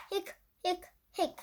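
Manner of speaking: high little hiccups; hiccup sounds. A narrator's reading. Narrator voicing the skeleton's hiccups, high and light. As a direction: squeak out each hic like a hiccup.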